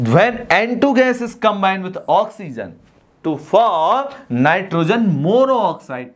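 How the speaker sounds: very slow, word-by-word dictation of a definition. Shown as a demonstration. Teacher dictating a definition to students, very slowly. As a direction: read it out slowly so the class can write it down.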